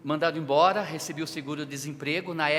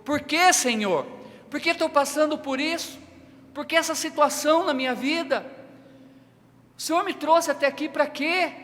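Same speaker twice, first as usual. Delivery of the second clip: higher and louder, pleading why to the Lord; anguished crying out to God; 9 semitones higher, 6 dB louder than usual. Retold or imitated. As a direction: cry out to God in distress, voice raised high. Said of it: "talvez você falou como eu".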